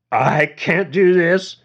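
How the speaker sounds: put-on high slow drunk half-shout; almost shouting. A narrator's reading. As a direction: nearly shout it, slurring. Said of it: "James almost shouted".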